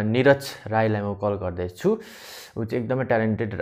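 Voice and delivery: normal talking voice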